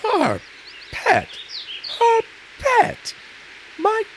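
rising shriek of offended pride; outraged. Acted. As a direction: shriek it, insulted. Owl offended at being called pet.

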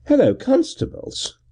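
high, breezy gentleman calling out; airy high greeting. A narrator's reading. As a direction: hail the constables from afar. Gervase calls out.

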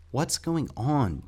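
put-on high, drawn-out worried boy; anxious question. A narrator's reading. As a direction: ask it slowly, voice rising in alarm. Anxious boy questions his father.

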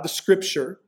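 ordinary talk

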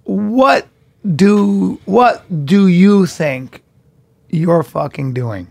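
usual speaking voice

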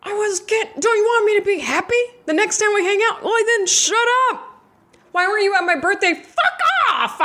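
fast, high, shrill-free mock outburst; mock peeved rant at nagging friends.

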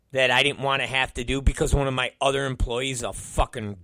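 ordinary talk, nothing marked

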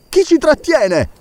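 high, panicked shout; terrified cry. Acted. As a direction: cry out in sudden fear. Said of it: "gridò il dottore con accento di terrore". (grido di terrore del dottore, molto acuto)

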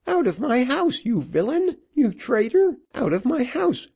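very high-pitched furious widow; high, outraged ordering-out; angry cry. A narrator's reading. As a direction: drive him out with shrill, high cries. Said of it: "cried the widow".

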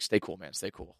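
low, hushed mock warning; furtive aside. Man to his accomplice as police arrive, furtive. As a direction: keep it very soft and low, under cover. Conspiring before police, acted.

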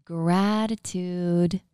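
stretched out very slowly; slow emphasis. Shown as a demonstration. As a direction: savor the word gratitude. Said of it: "the G word".

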